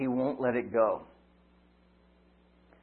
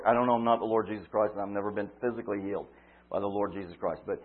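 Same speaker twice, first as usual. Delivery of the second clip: usual speaking voice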